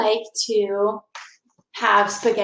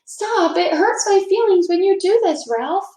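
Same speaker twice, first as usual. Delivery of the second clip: put-on higher child's voice, hurt and pleading; Lucy pleading; 9 semitones higher, 3 dB louder than usual. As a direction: beg the bully to stop, voice up high.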